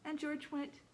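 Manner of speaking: ordinary delivery, nothing marked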